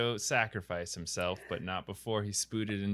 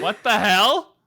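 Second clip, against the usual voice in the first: shouting; 10 semitones higher, 12 dB louder, slower than usual. Real, not acted. Outraged reaction to the ending.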